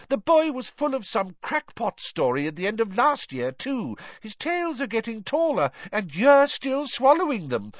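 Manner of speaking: high, exasperated tirade; blustering official losing his temper; angry. A narrator's reading. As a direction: rant with mounting disbelief, pitched high. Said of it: "said Fudge angrily".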